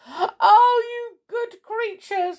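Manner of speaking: high, loud tearful cry; sobbing. Acted. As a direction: sob it out in relief. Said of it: "sobbed Amelia Jane".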